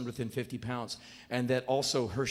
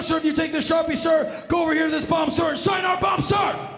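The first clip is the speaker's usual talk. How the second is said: barked, very high and loud military shouting; shouting drill sergeant; 13 semitones higher, 9 dB louder than usual. Retold or imitated. Weapons loader shouting at the visiting comic. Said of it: "He only shouted".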